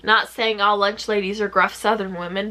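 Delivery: ordinary talk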